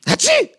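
high, sudden spoken sneeze; acted sneeze. Sick kangaroo interrupting the story, acted high sneeze. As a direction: burst out a squeaky mock "hatschi". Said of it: "macht das Känguru".

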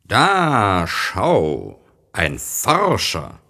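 put-on an old scholar spots a visitor; old man calling out. A narrator's reading. Old gentleman calls out to the little prince. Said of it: "rief er".

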